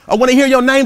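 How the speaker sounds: high, loud, rousing preacher's call; preaching. Acted. Motivational speaker rousing the audience, preaching loud. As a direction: preach it to the whole crowd, big and lifted.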